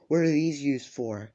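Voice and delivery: imitating a screaming girl, half-hearted; retold screaming. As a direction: yell the questions in confused panic. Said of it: "like, fucking screaming".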